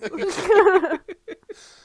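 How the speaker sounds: a bright rising burst of laughter; loud laugh. Real, not acted. Laughing at the bit.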